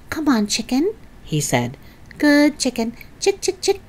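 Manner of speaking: put-on boy luring a chicken to a spoonful, coaxing. A narrator's reading. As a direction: sweet-talk the hen toward the spoon.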